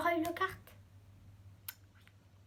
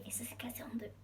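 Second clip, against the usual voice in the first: whisper; 3 semitones lower, 14 dB quieter, faster than usual. Real, not acted. Confessing breaking the pop.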